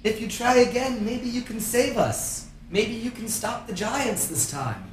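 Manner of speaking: high, hopeful pleading; pleading crowd voice. Acted. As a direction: beg them to try again. Townsfolk illusion pleading.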